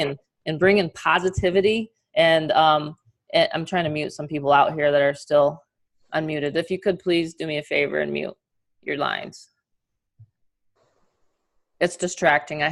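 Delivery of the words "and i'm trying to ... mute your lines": ordinary talk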